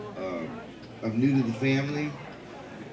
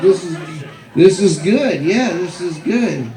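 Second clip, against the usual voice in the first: pleased; 5 semitones higher, 5 dB louder than usual. Retold or imitated. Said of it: "I was like".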